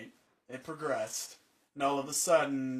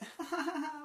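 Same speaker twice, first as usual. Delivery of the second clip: mock giggle; 4 dB quieter than usual. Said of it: "she would act all like".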